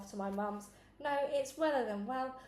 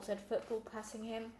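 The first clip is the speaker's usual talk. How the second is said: ordinary delivery, nothing marked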